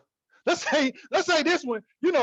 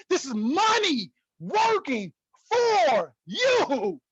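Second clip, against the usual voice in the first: slow emphatic; 2 semitones higher, much slower than usual. Real, not acted. Stressing the key message slowly.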